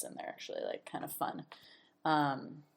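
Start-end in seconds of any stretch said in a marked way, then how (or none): 0.0-1.4 whispered aside. Real, not acted. Aside about British witches.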